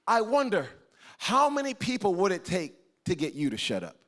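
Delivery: ordinary talk, nothing marked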